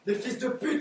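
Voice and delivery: usual speaking voice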